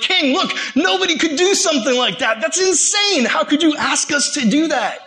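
high-pitched panicked protest, acted out; mock freaking out. Wise men protest the king's impossible demand, freaking out. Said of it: "these guys are freaking out".

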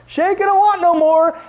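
high, loud gloating; gleeful gloat. Real, not acted. Gloating that his sister will be too full, high and loud. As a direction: crow over it with glee.

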